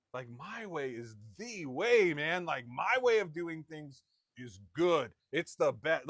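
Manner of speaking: loud, drawn-out stress on "my way" and "the way"; emphatic self-assurance. Acted. Stubborn believer defending own way of doing things, loudly emphatic.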